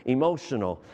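ordinary talk, nothing marked

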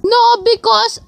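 high, whiny put-on voice; squeaky begging voice. Fake angel begging for halo-halo in a squeaky voice. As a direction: beg then refuse like a bratty child.

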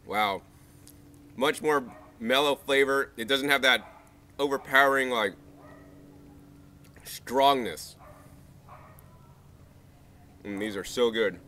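muffled eating speech; talking while chewing. Real, not acted. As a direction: mouth full of fruit. Seen 2: Mouth working on fruit; bites flesh at 11-12s.

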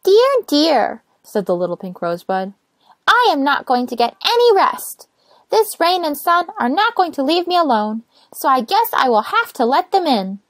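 high, loud sighing give-in; resigned little rosebud. Acted. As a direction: give up with a fussy sigh. Rosebud gives in.

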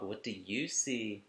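usual speaking voice

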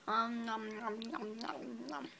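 soft whispered nom nom; whispered chewing noises. Real, not acted. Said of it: "If I can eat this little last piece of Skittle".

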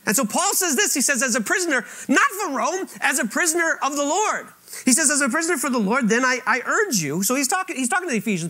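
high, loud, driving sermon voice; full-force preaching. Acted. Preacher urging the congregation, full-force preaching. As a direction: preach it out with rising fervour.

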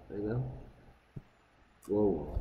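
normal talking voice

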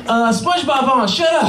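put-on high-pitched impatient child yell; bratty kid. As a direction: shut the grown-up down like a rude little kid. Nephews rudely silence uncle.

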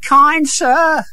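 high, pitiful put-on sick voice; feigned weakness, pitched high; pleading beggar.

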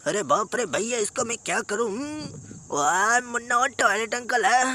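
normal talking voice

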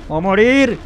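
excited shout. Real, not acted. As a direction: build it to a shout. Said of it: "repeated rising prediction".

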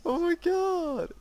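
high, drawn-out exclamation; long incredulous squeal after laughing; amused disbelief. Real, not acted. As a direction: cry it out slowly, high-pitched. Disbelief after missing both shots.

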